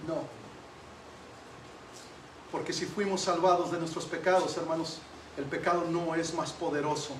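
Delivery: low, soft sermon voice with long pauses; subdued preaching. Real, not acted. Preacher assuring brothers nobody can snatch them from Christ, subdued preaching. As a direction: bring it right down and reason gently. Preacher reasons gravely with congregation.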